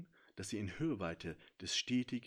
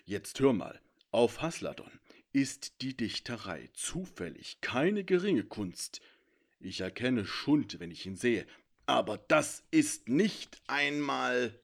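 a proud warrior, louder and slowing as he bristles; indignant centaur; 2 semitones higher, 8 dB louder, slower than usual. A narrator's reading. Centaur bristles at the dragon's jab, louder and slow. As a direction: defend Hasladon's poetry with wounded pride.

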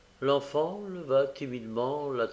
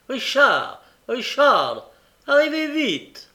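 put-on high, loud shout for a child; calling out; 12 semitones higher, 9 dB louder, slower than usual. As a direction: summon him at full voice. Mme Mann calls the boy. (appel crié, fort et aigu)

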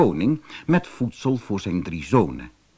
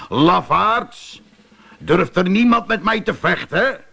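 higher, louder mocking giant call; giant's taunting shout; 7 semitones higher, 4 dB louder than usual. A narrator's reading. Goliath mocks the Israelite soldiers, loud taunting shout. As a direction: jeer at the cowards across the field. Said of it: "Toen riep hij".

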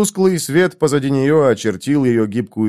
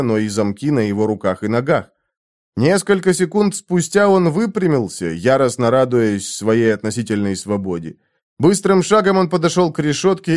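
ordinary delivery

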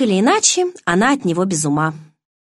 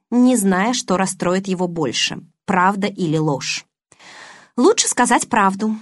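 usual speaking voice

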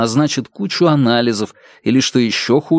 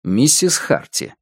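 ordinary delivery

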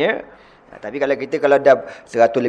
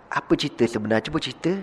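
softer, calm coaxing; gentle coaxing; 7 dB quieter, faster than usual. Retold or imitated. The Prophet gently asking Salman, soft coaxing. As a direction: ease the story out of him softly. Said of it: "Nabi kata".

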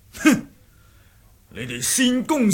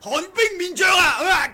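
shouted attack name; 11 semitones higher than usual. Acted. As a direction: roar the move as you strike. Wei shouts his technique name. (大喝招式名)

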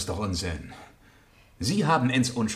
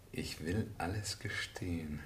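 slow, hushed whisper to himself; a slow secret whisper, voicing what he writes; 2 semitones lower, 8 dB quieter, slower than usual. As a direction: breathe the words as he forges them. Villain writes fake confession.

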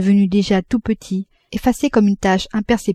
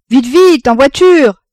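high, loud urging shout; shouted hurrying; 7 semitones higher, 10 dB louder, slower than usual. A narrator's reading. Father herding the family to the carriage, shouting. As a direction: yell at them to get moving. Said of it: "criait le père".

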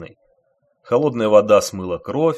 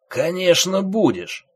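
slow, higher menacing reply; 8 semitones higher, 3 dB louder than usual. A narrator's reading. Thief threatening the passenger with the knife, slow menace. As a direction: make the promise sound like a threat. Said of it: "с угрозой сказал вор".

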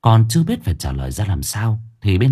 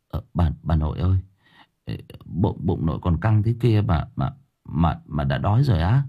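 a lightly voiced boy, hesitant and softer; stammering boy; 4 semitones lower, 4 dB quieter than usual. Boy stammering to his ravenous grandmother, softly uneasy. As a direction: stumble over the words, unnerved.

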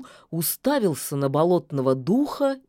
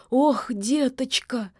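sniffling; 8 semitones higher, slower than usual. Acted. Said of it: "шмыгнула носом лишачиха".

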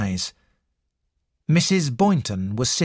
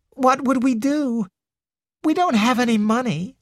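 put-on high, timid young girl; 11 semitones higher than usual. Girl's voice in read story.

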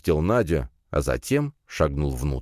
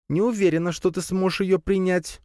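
high-voiced small familiar, unsure; doubtful spider pet; 14 semitones higher than usual. A narrator's reading. Spider warns master he may not cope, doubtful. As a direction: pitch it up as a hesitant pet.

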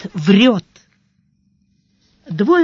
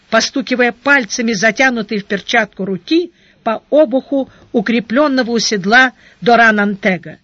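ordinary delivery

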